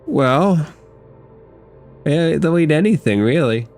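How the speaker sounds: ordinary talk, nothing marked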